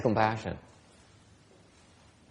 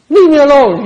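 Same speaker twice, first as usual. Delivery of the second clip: much higher, much louder laughing cry; mock shrill refusal; 12 semitones higher, 18 dB louder than usual. Teacher acting out the wife's reaction, laughing. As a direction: shriek it like a spoiled brat. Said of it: "what do you think she's gonna do".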